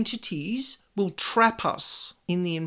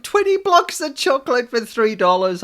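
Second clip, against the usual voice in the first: excited; 3 semitones higher, 6 dB louder than usual. Retold or imitated. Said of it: "I'm really excited".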